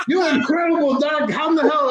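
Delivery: high, excited mock praise; amazed exclaim. Patient marvelling at his doctor, high excited exclaim. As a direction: gush like a dazzled patient.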